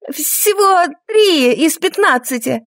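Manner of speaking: stammering. A narrator's reading. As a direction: stammer it out nervously. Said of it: "заикаясь, проговорила женщина".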